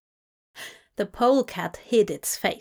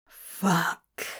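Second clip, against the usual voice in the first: low grumbled curse; 4 semitones lower, 3 dB quieter than usual. A narrator's reading. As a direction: grumble it under your breath. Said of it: "he grumbled".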